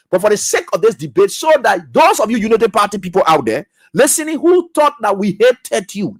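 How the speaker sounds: ordinary talk, nothing marked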